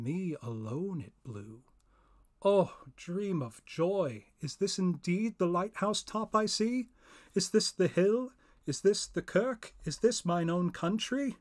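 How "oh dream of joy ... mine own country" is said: higher, louder, amazed cries; disbelieving joy at seeing home; joyful wonder. Acted. Mariner sights his homeland.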